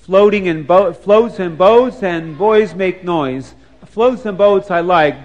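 sing-song rhyme with drawn-out words; playful rhyme. Real, not acted. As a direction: chant the rhyme slowly, punching each rhyming word. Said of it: "This is a rhyme day".

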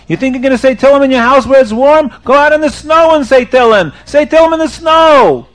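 loud, high scolding, climbing; indignant order. Acted. Rabbi sends the sinner into the snow, indignant order. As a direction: berate him with rising insistence.

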